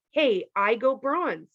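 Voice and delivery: ordinary delivery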